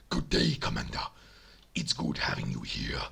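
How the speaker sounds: put-on very low, rough Uruk voice with an accent; gruff, deep accented orc greeting; accented character voice. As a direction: drop it deep and raspy, accented. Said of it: "why I gave him an accent a-, apart from the voice as well".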